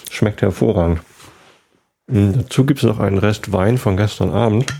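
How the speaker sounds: talking with a mouth full of bread; chewing between words, mouth full. Real, not acted. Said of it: "mit vollem Mund podcasten". (mit vollem Mund)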